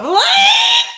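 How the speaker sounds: high, loud grossed-out squawk; exaggerated disgusted shriek, like throwing up; mock retch. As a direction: gag it out as one loud, high-pitched yelp. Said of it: "made me wanna throw up".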